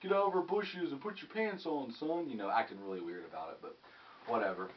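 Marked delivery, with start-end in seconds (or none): 0.0-2.3 cop voice. Retold or imitated. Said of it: "the cops were like".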